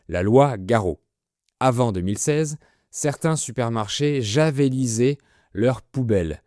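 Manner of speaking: ordinary talk, nothing marked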